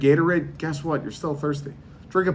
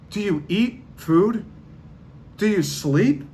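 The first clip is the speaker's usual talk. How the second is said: slow, higher, sarcastic questions; higher, mocking questions about eating and sleeping; 6 semitones higher, slower than usual. Real, not acted. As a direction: quiz them like they're not human. Mock questioning of water-hater.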